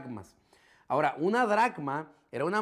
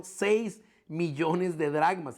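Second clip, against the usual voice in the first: "Seis millones de dracmas" drawn out word by word; slow heavy emphasis; much slower than usual. Real, not acted. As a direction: let the number land slowly. Repeats the sum for effect.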